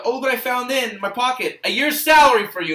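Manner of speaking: high, loud mock-boastful movie line; DiCaprio. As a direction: imitate a rich man taunting a cop. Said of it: "DiCaprio's like".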